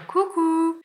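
high, sing-song coucou; cute little hello. Retold or imitated. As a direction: chirp it up high like a shy wave. Petit coucou discret de la main. (petit coucou aigu et chantant)

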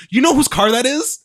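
high-pitched mock confrontation; acted confrontation. Shop owner confronting the young car thief, mock confrontation. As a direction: challenge him, pitched well up.

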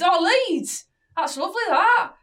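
loud, high put-on Leeds accent; mimicked Leeds accent. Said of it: "I mimic accents a lot".

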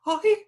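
very high, loud squeak of the name; sudden squeaky outburst, far above normal pitch; playful silly name-calling. Shown as a demonstration. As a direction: yelp the name out like a silly noise. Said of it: "because you can go".

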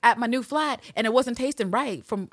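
mock eureka. Villanelle's sudden realisation.

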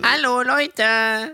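high, slow drawn-out hello; old lady greeting. Acted. Old woman greets slowly. (langgezogenes Hallo einer alten Frau)

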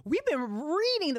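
ordinary talk, nothing marked

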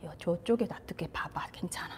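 put-on hushed whisper to a scared child; soothing mother's whisper; whispering comfort. Shown as a demonstration. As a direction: whisper reassurance while holding them. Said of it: "안아주면서".

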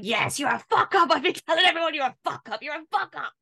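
high, loud, gloating and delighted; gleeful gloating. Retold or imitated. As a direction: revel in his failure, crowing. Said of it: "She's, like, gleeful".

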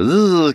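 short, loud, high buzz; bumblebee buzz. Acted. Bee summons the other bees, buzzing. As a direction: buzz to call the swarm. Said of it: "it said to all the other bees".

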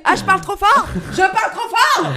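high, loud hammed-up shouting; drama queen yelling. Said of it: "t'en rajoutes des caisses de drama queen". (hurlement de drama queen exagéré)

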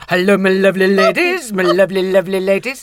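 loud, cooing greeting to her roses; sing-song to roses. Retold or imitated. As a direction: croon it out to the flowers like old friends. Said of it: "when I go".

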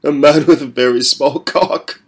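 put-on defiant young captive; boy's defiant jibe. A narrator's reading. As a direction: spit the insult back. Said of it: "he replied".

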